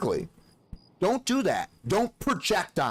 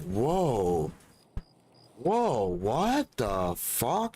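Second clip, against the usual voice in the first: slow amazed whoa; much slower than usual. Retold or imitated. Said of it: "I'm just like".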